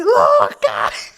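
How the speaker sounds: high, loud shocked cry of the name; mock scolding yell. [name] calling out her blunt son, high laughing yell.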